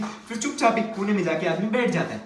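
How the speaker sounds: normal talking voice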